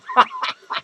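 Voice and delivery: high-pitched cackle; high, squealing burst of laughter; cackling laugh. Acted. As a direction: cackle high at your own joke. Laughs about getting drunk.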